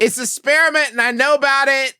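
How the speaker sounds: high, loud public announcement; announcing loudly. Pretend. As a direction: proclaim it to the whole room. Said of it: "announcing loudly".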